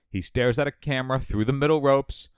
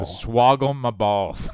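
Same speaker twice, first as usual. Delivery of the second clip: slow catchphrase; slower than usual. Pretend. Mock wrestler catchphrase.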